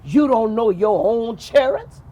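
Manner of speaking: scolding. Acted. Angry wife scolds king.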